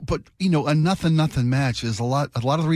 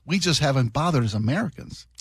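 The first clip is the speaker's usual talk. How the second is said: usual speaking voice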